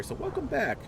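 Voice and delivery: high, bright welcome back; warm welcome. Real, not acted. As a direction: greet them warmly. Welcoming returning viewer.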